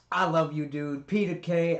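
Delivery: ordinary delivery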